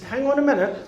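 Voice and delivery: high-pitched plea to wait; insistent interjection. Retold or imitated. Guest stopping the bishop, insistent.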